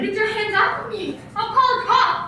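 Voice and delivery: loud, rising alarm at a grabbing stranger; a woman yelling at a man to let go; frightened shout. Acted. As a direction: shove him off with your voice, threaten to call a cop. Stranger grabs her, she threatens.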